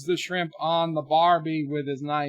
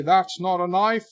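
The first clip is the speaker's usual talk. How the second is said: put-on catchphrase in a louder, higher Australian accent; mock Australian accent; 5 semitones higher, 7 dB louder than usual. Asking the Australian caller about the film line, mock Australian accent. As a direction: put on an Aussie film-hero voice.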